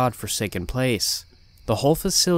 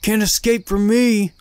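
menacing high, loud yell; shouting; 6 semitones higher, 5 dB louder than usual. Acted. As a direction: roar the threat at him. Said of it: "he started shouting".

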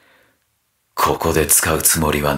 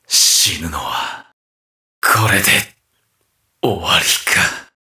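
dying murmur; 9 semitones lower than usual. Acted. Fighter facing his own death, low fading voice. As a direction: let it sink low, as if fading.

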